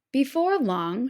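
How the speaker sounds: ordinary delivery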